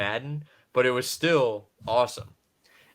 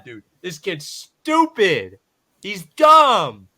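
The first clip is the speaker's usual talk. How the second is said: loud, slow exasperation; rising to a loud groan of disbelief; exasperated; 7 semitones higher, 10 dB louder, much slower than usual. Retold or imitated. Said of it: "I was like".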